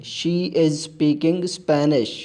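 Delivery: very slow, careful reading for learners; slow model sentence. Shown as a demonstration. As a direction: lay each word down slowly. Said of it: "वो Spanish बोल रही है".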